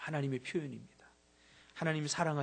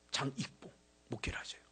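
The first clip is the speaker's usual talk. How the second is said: unvoiced confiding whisper; whispered aside; 7 dB quieter than usual. Real, not acted. Preacher whispering an aside to the congregation. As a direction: let them in on it softly.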